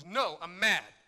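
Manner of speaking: mad retort. Retold or imitated. Pastor snapping back at God's prompting, loud and fed up. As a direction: bark it back, fed up and defiant. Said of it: "My immediate response ... was".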